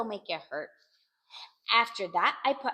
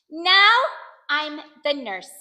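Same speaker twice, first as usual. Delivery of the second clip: high, loud NOW, then slower; proud announcement; 4 semitones higher, 9 dB louder, slower than usual. A narrator's reading. Child playing nurse declares the new role, proudly. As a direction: announce it with a drawn-out flourish.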